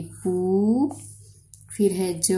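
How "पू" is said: sounding out words. Shown as a demonstration.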